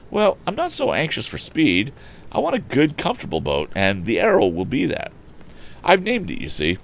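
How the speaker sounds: high, lilting youthful voice; animated boyish figure; Tom, eager young inventor. Acted. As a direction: explain your plans brightly to your father. Voices the son.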